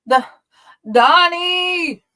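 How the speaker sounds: high, loud anguished shout. A narrator's reading. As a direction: cry out his name in despair. Calling to his friend.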